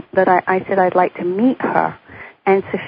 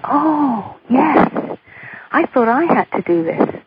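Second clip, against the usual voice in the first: sudden realization; 6 semitones higher, slower than usual. Retold or imitated. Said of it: "suddenly then I, I remembered".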